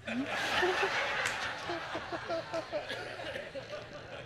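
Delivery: breaks into a long, high laugh; real laughter from the pulpit. Real, not acted. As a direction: laughs at his own punchline. Laughing at own joke.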